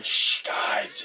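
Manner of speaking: forceful acted shush; urgent stage-whispered hush; loud shushing. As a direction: hush the group with a loud, airy shh. Character hushes the laughing group.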